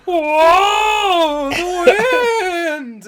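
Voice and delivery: high, loud, drawn-out wail; mock windblown cry. People blown by Zod's super breath, hammed-up wail. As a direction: cry out as if being blown away. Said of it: "five minutes of people going".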